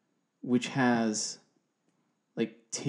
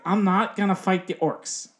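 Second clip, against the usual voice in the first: higher, louder mock-protesting wife; hammed-up wifely objection; wife imitation; 7 semitones higher, 5 dB louder than usual. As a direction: imitate a wife flatly refusing to play. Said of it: "She's like".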